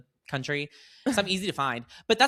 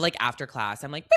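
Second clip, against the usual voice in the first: normal talking voice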